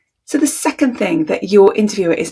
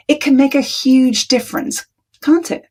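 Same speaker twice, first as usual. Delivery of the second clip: raised pitch, "can't it" lifting even higher; statement turned question; 6 semitones higher than usual. Shown as a demonstration. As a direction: weaken the claim with a high questioning "can't it". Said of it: "I just added two words".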